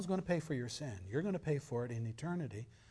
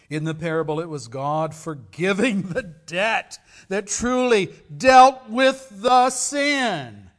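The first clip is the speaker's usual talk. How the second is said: louder and higher, slowing hugely on the last words; preaching at full force; 5 semitones higher, 15 dB louder than usual. Real, not acted. Preacher proclaiming to the congregation that God forgave the debt, in a full shout. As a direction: build to a thundering climax.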